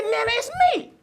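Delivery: own voice to grandma. Retold or imitated. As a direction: break into a high laughing "it's me". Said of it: "I said".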